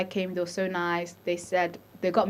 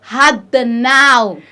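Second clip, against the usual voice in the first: slow, loud, strained through a contraction; strained in labour; 3 semitones higher, 10 dB louder, much slower than usual. Real, not acted. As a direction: squeeze the words out mid-contraction. In labour, groaning the words.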